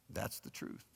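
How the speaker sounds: whispered punchline. Real, not acted. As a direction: drop to a bare whisper for the point. Quiet punchline after pause.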